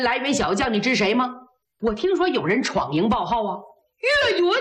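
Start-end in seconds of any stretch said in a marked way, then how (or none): 4.0-4.5 high, emphatic name reveal; excited announcement. A narrator's reading. General reveals the young fighter to the emperor, excitedly. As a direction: announce it as big news. Emperor asked who the newcomer is.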